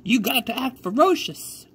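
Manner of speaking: put-on high old lady shooing the birds; old country woman. A narrator's reading. Old lady urges her scarecrow to shoo the crows, high and old. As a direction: urge him on like a fussy granny. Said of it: "said the woman".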